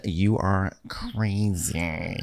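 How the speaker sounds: slow, drawn-out scold; drawn-out mock warning. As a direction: stretch it out like a stern warning.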